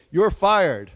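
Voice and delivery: loud, punchy boss line; Trump catchphrase. Retold or imitated. Teacher quoting Trump's show line, punchy. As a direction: bark it out like a TV boss firing someone. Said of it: "Donald Trump would say".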